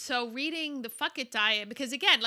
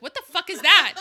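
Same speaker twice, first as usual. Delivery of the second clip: loud, high, indignant; mock-outraged cry at the diet book; outraged exclamation; 8 semitones higher, 14 dB louder than usual. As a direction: blurt it out in disbelief. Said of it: "She's like".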